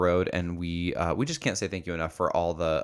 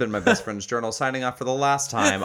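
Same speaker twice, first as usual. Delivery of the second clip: louder, bright radio sign-off; announcer sign-off; 4 semitones higher, 4 dB louder than usual. Real, not acted. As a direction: announce the ending grandly.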